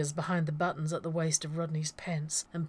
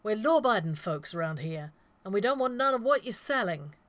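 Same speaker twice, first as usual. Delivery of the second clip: teller voice; 4 semitones higher, 6 dB louder than usual. A narrator's reading. Teller pleads during robbery.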